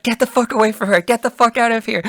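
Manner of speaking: high-pitched, urgent retold demand; retold angry threat. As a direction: act out warning him off, pitched right up. Threatening the man with a knife.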